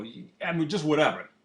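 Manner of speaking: ordinary delivery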